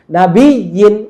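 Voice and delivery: নাবিয়িন with weight on বিয়ি; নাবিয়িন with a strong, lengthened middle; force on doubled ya. Shown as a demonstration. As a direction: press and hold the doubled y in নাবিয়িন. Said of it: "একইভাবে".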